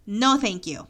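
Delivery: ordinary delivery, nothing marked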